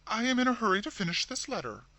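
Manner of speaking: short. A narrator's reading. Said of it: "replied Caroline shortly".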